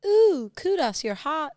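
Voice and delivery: high, loud, gushing ooh; hammed-up flirty kudos. Joking about Strava flirting, gushing hammed-up ooh. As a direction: swoon over the kudos. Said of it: "Strava kudos".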